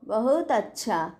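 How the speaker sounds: a lightly voiced servant answering his master; caretaker's reply. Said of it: "বলে চলে গেল".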